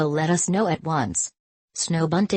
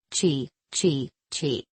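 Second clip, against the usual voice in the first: lightly chirped robin call; robin's cry; 2 semitones lower, slower than usual. Acted. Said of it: "cried Robin Rusty Breast".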